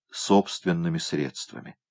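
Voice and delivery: usual speaking voice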